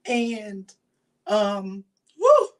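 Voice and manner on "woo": a sharp high woo to steady herself; tearful woo. Real, not acted. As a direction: blow out the tears with a loud, high woo. Holding back tears on camera.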